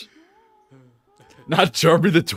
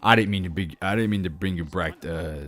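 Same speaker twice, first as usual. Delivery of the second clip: low, soft, halting apology; subdued and hesitant, much lower and softer; sheepish apology; 9 semitones lower, 8 dB quieter than usual. Real, not acted. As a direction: apologise quietly, trailing into a mumble. Apologising to returning viewer.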